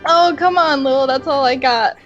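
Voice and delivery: ordinary delivery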